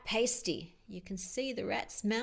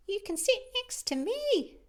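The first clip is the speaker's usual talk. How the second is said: very high, tiny owl voice; little Titch; 11 semitones higher, 4 dB louder than usual. Acted. Titch offering Al a seat, very high and small. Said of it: "said Titch".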